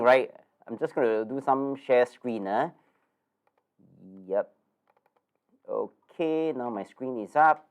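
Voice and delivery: usual speaking voice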